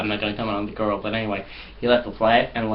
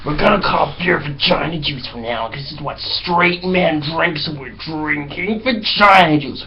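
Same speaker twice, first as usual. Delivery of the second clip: higher, slow put-on bro voice; mock dumb American bro; 6 semitones higher, slower than usual. Pranking friends on the bus, hammed-up bro voice. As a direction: ham up a dim macho American. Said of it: "And they're like".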